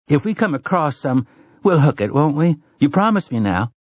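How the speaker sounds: high, wheedling woman's voice; coaxing Susanna. Acted. Woman making the gunslinger promise her coffee, high and coaxing.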